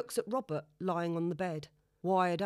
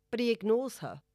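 plain reading voice

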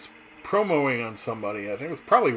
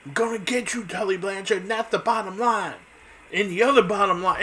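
Dusty Rhodes promo; 9 semitones higher than usual. Retold or imitated. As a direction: rant at Ric Flair like Dusty in the ring. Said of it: "'cause he goes".

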